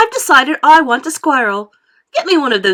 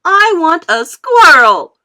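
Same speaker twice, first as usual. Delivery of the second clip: loud, high whining tantrum; 7 semitones higher, 11 dB louder than usual. A narrator's reading. Spoilt girl throws a tantrum at Mummy, loud and whiny. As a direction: stamp your foot and whine.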